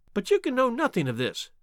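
high-pitched little man; uneasy, high protest; nervous disbelief. A narrator's reading. Nervous little man doubts Holmes.

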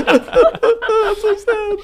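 very high, slow, laughing half-cry; real laughter over a mock wail; laughing mock sob. Real, not acted. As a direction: laugh through a pretend sob at how sad it is. Laughing at sad image.

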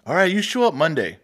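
the Mexican shop boss sizing up the kid, mock Mexican accent; imitating boss's Mexican accent. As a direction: lightly put on the old boss's Mexican accent, teasing. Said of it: "thick Mexican accent".

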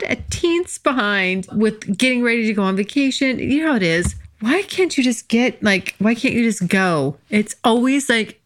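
ordinary talk

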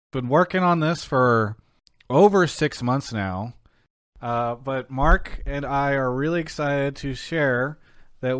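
building up to a big reveal; announcing big news. Real, not acted. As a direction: hype announcement, rising to a shout. Host announcing sponsorship news.

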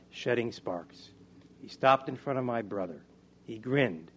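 usual speaking voice

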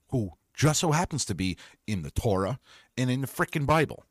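normal talking voice

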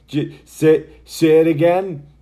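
loud, high, teasing demand for a repeat; pestering Americans begging for the accent; mocking fan. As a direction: egg him on like a pestering fan. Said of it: "sick of people going".

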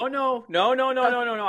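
high, loud run of no's; excited protest. Real, not acted. Cohost jumps in to stop him moving on, high excited protest. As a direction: cut him off with a string of eager no's. Protesting, won't let it drop.

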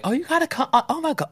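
high-pitched mock cheerful pleading; mock eager hikers. Mocking the hiking group urging her to come, high and eager. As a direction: parody overeager hikers begging her along.

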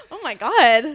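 real shock at the reveal; a sharp gasp then a stunned oh my God. Real, not acted. Gasp at the twist.

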